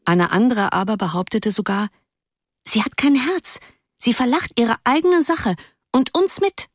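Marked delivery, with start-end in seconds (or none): 2.7-6.7 lightly voiced girl, high and accusing; a girl scolding her heartless friend; mild schoolgirl indignation; indignant girl. A narrator's reading.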